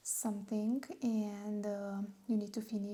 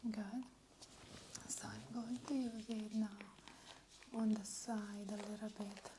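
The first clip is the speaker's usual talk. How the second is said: whispered asmr role play; 3 semitones lower, 14 dB quieter than usual. Acted. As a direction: whisper soothingly as if massaging. Scalp massage in ASMR whisper.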